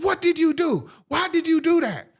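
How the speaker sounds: mock teacher's outraged questioning, high-pitched; retold angry demand. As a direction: demand answers as the shocked teacher, pitched well up. Retold teacher confronting him after punch.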